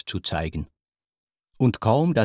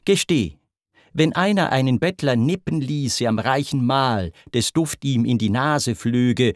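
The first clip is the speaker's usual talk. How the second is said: plain reading voice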